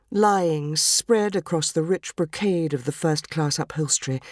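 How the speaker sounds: usual speaking voice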